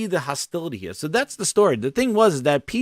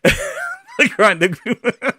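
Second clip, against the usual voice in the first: real laughter; 7 semitones higher than usual. Real, not acted. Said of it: "I can't keep a straight face".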